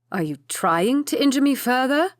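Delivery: sharp, loud pained rebuke; angry. A narrator's reading. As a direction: snap at him through the pain. Injured lord snaps at clumsy valet.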